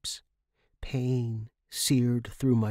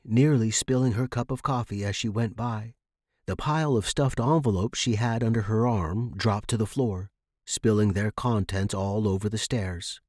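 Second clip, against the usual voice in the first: ordinary delivery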